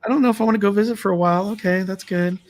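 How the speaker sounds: nervous voice climbing high and fast; rattled. Retold or imitated. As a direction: laugh off the fright, shaken. Retold shaken reaction after ghost.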